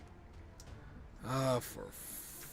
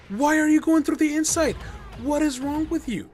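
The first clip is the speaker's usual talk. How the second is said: high, loud incredulous scolding; exasperated outburst; 13 semitones higher, 4 dB louder than usual. Real, not acted. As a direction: scold the soldier in high disbelief. Exasperated rant at game AI.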